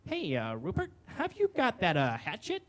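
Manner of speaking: tentative, hesitant mock request; gingerly. Woman asks her friend for a hatchet, gingerly. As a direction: broach it carefully, with little pauses. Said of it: "rather gingerly says".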